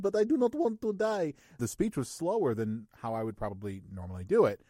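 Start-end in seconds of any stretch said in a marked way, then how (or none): 0.2-1.3 put-on high, loud but measured Russian accent; controlled Russian plea. Threatened Russian hacker not talking fast, controlled plea. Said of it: "It was still just very controlled".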